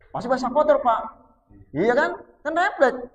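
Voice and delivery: high, loud preaching; emphatic preaching. Acted. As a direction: drive the point home loudly. Preaching at full force. (berkhotbah lantang dengan nada tinggi)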